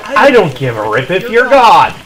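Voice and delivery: ordinary talk, nothing marked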